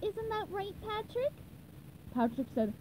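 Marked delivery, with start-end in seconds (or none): 0.0-1.3 high, soft, rising question; gentle, high cartoon prompting; SpongeBob. A narrator's reading. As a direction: ask a friend softly in a high voice. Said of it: "SpongeBob turned to his best friend".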